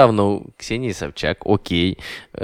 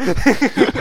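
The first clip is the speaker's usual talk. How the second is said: high burst of laughter; 12 semitones higher than usual. Real, not acted. Laughs at the book plug.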